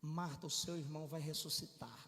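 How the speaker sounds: low, soft, even delivery; gentle, unhurried reassurance; calm Jesus. Acted. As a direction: say it quietly and tranquilly. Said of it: "Olha a diferença da expressão de Jesus".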